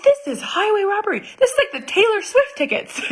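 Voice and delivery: high, fast indignant squeal; mock outrage. Mom outraged at shoe prices, high and fast. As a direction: cry robbery with hammy outrage. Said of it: "I'm like".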